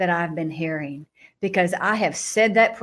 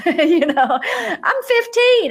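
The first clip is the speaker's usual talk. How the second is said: laughing; 11 semitones higher, 7 dB louder, much slower than usual. Real, not acted. Laughing at her teenage self.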